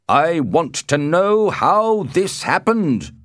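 high, loud, slow demand; commanding shout. A narrator's reading. The Prince arrives at the bodies, shouting his demand. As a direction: demand answers in a drawn-out shout. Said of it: "shouted the prince".

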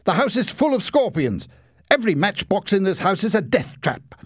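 high, loud accusing shout; panicked high-pitched cry of blame. A narrator's reading. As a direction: shout it out in alarm. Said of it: "shouted Larry".

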